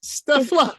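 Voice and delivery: high, loud mock swoon over Stifler; swooning fan. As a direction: squeal it like a smitten fan. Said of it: "everybody in the world went".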